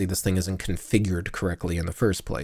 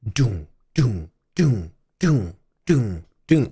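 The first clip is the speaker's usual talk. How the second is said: beat sounds; much slower than usual. Shown as a demonstration. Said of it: "have that, like".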